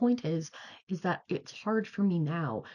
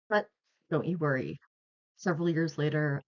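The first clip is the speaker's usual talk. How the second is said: normal talking voice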